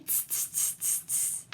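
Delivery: soft unvoiced tss tss of teasing monkeys; soft monkey tutting; monkey tsk. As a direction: tsk at him like cheeky monkeys. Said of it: "shook both their hands at him and said".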